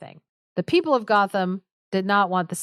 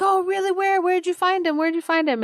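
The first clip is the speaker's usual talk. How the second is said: much higher, excited mock questioning; eager questioning; 11 semitones higher, faster than usual. Men hearing their lost friend is found, eager questioning. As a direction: pipe up in hammed-up eagerness.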